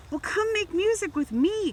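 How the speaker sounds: a xylophone calling the mouse over to play; cheerful call. A narrator's reading. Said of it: "called the xylophone".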